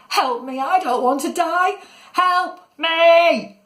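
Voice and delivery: high, panicked cry for help; terrified. Acted. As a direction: build to a loud, high scream. Falling boy screams for help.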